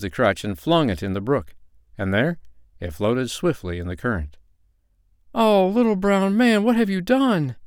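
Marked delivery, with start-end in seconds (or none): 5.3-7.6 put-on high, distressed little girl; acted lament, pitched well up; tearful wail. A narrator's reading. Girl sees her crutch thrown away, weeping wail. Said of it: "wept little Nanny".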